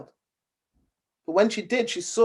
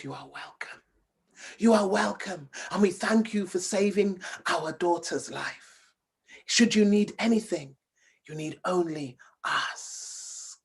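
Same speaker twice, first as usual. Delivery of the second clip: soft, breathy, hissing snake voice begging for help; a tiny frightened snake calling out in a whisper; 5 dB quieter than usual. Acted. Small snake begging the princess to hide it, hushed whisper. Snake king and queen speak.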